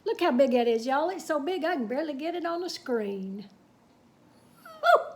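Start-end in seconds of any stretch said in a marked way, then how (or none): 4.6-5.0 high, loud joyful whoop; excited whoop. Real, not acted. Reader marvels at the huge picture, excited whoop. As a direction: let out a delighted yell.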